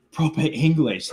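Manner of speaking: lightly put-on British accent, near Cockney; proper English accent. Describing the actor's real accent, imitated near-Cockney English. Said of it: "kinda bordering on Cockney sometimes".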